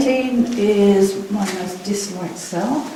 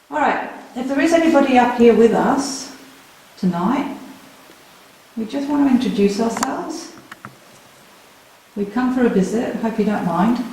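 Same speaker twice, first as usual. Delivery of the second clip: calling out to spirits; 4 semitones higher than usual. Real, not acted. Calling out to spirits in a dark cell.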